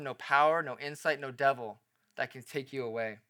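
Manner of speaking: soft, low, earnest preaching; hushed reassurance. Real, not acted. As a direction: bring it down low and quiet to reassure the flock. Pastor reassures congregation after climax.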